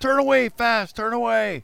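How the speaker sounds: high-pitched pretend urgency; mock panic. Imagined director ordering the camera off the shirt, mock panic.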